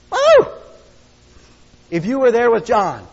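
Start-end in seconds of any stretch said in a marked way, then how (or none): 0.1-0.5 high, loud joyful whoop; exultant whoop. Real, not acted. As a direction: let out a whoop of joy. Preacher's exultant cry.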